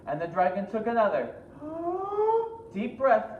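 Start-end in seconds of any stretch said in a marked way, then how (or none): 1.4-2.7 huge, high, drawn-in gasp; big inhale. Acted. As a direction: suck in air like a dragon. Dragon inhales deeply.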